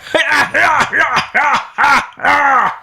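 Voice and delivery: big high cackle from the back; a sudden high-pitched laugh from the crowd; loud high laugh. Real, not acted. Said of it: "was you just doing that laugh".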